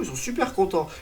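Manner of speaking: usual speaking voice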